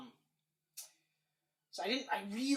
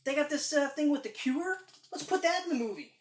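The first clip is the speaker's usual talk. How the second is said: eager exec pitch; 6 semitones higher, 4 dB louder than usual. Retold or imitated. Voicing the studio executives.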